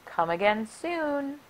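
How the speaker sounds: plain reading voice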